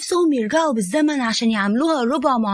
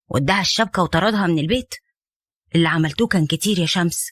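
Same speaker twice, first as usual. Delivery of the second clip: Safwan's voice; 8 semitones lower than usual. Acted. Safwan asking crying Shams what's wrong, low and concerned. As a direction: lower male voice, gentle but reproachful.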